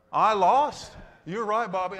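loud, high burst; exclaimed. Real, not acted. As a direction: admit defeat with a loud cry. Laughing self-defeat in sermon.

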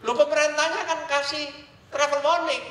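tinggi dan lebih keras, heran; heran, menanyai turis; surprised question. Retold or imitated. (tanya heran, nada tinggi)

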